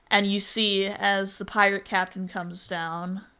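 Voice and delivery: ordinary delivery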